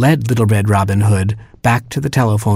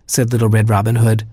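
ordinary delivery, nothing marked

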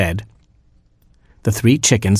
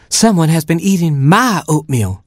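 higher mother hen voice; a fussy mother hen, pitched up; Mama Hen voice; 9 semitones higher than usual. Acted. Mother hen finding her oatmeal eaten, higher voice.

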